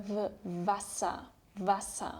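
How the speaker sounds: Wasser said as vasser, W like English v; German W as V. Shown as a demonstration. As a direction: start Wasser with a clear v sound. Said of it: "sounds like the English V".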